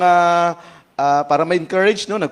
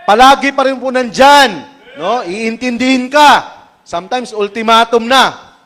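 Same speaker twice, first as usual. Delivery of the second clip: preaching at full force; 5 semitones higher, 8 dB louder than usual. Acted. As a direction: plead for respect for the pastor. Preacher exhorts about man of God.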